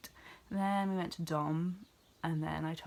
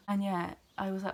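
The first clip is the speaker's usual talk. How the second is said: ordinary talk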